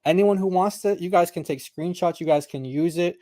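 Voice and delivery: ordinary talk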